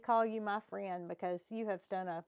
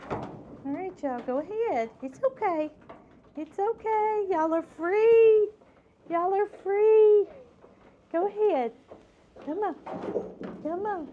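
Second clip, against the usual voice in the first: tender coaxing; 8 semitones higher, 6 dB louder than usual. Real, not acted. Rehabber coaxing her fawns out into the wild, high and tender. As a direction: coax the babies out gently.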